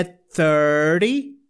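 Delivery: thirty with the T as a quick flap; flapped T. Shown as a demonstration. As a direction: tap the T in thirty. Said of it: "ele meio que vira um flap". (thirty com T batido)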